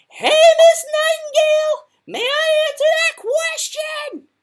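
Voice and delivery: high, slow, very loud shout; head-splitting yell. A narrator's reading. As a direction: bellow it across the classroom. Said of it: "head-splittingly loud".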